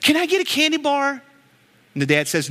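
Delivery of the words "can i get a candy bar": put-on higher childish ask; kid asking. A five-year-old begging his dad for candy, high pleading kid voice. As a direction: ask like a little kid in line. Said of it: "he says".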